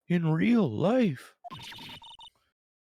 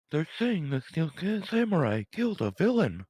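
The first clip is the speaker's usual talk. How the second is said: usual speaking voice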